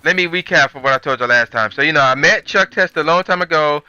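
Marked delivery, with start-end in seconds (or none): none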